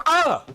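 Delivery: very high mock shriek of fright; startled yelp. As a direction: yelp as if caught off guard. Startled by wife behind him.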